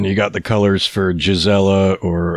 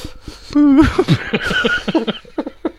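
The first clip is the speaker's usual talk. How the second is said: very high falling giggle; high cartoon-kid giggle; squeaky laugh.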